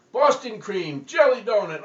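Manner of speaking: booming roll call; hollered. Acted. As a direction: shout out each donut name. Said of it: "he went through 'em".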